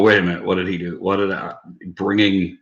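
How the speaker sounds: ordinary talk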